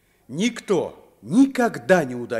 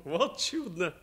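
amused laugh; 2 semitones higher, 7 dB quieter than usual. Acted. Смеётся над титулом барона.

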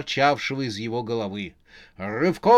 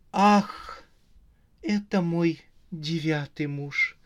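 high "ah", then slow recognition; exclaiming; 3 semitones higher, slower than usual. Acted. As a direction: gasp the first word, then stretch it out. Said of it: "воскликнула Рожа". (удивлённое восклицание)

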